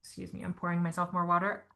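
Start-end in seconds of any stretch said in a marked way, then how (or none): none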